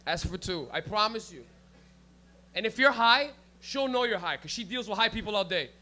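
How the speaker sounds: ordinary talk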